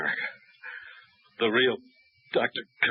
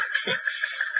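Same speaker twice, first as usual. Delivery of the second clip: high breathy burst of laughter; delighted laugh. Acted. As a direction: laugh at the big fee. Pilot delighted by the fee.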